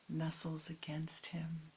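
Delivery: plain reading voice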